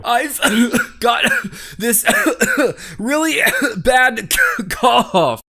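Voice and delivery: slow, loud hammed-up coughing through words; fake cough. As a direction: overact a bad cough. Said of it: "sounds genuine".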